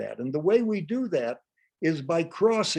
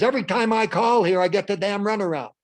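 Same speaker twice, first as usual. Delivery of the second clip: loud, raised-pitch complaint; angry customer; 6 semitones higher, 6 dB louder than usual. Acted. Customer complaining on a call, angry and loud. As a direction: complain hotly, at full volume. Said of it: "A customer says".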